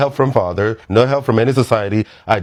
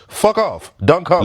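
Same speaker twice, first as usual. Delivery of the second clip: dismissive outburst; 8 semitones higher, slower than usual. Real, not acted. Dismissing critics of her harsh rules.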